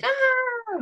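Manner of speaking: very high startled scream like a cartoon monster; kid's scream. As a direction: shriek as the monster slips. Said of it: "he'll, like, spin around".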